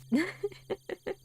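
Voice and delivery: squeaky burst of laughter; high giggle. Real, not acted. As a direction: giggle at your own silly drawing. Laughing at own drawing.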